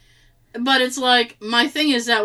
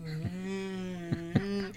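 squeegee noise; 3 semitones lower, 13 dB quieter than usual. Retold or imitated. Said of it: "you just go".

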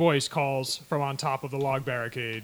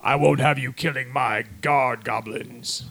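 goblin boss voice; 6 dB louder, slower than usual. Acted.

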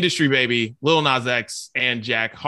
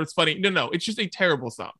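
usual speaking voice

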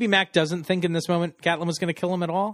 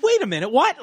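confused; 10 semitones higher, 4 dB louder than usual. Retold or imitated. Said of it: "It's like".

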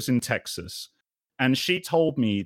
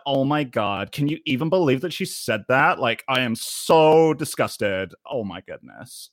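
put-on American Valley-girl disgust; Cali girl accent. Shown as a demonstration. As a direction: do a gushing Californian girl accent. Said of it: "like, Cali girl kind of, like, accent".

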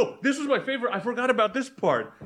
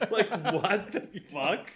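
ordinary delivery

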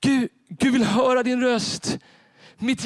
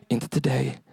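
enemy's whisper; 9 semitones lower, 4 dB quieter than usual. Acted. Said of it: "den där viskningen som inte är Gud".

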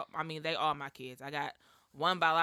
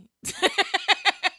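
high laugh; 11 dB louder than usual. Real, not acted. Laughs at apology.

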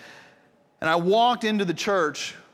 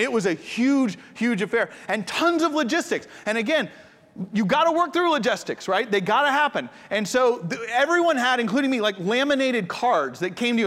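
excited build; 6 semitones higher than usual. Real, not acted. Building excitement about wedding logistics.

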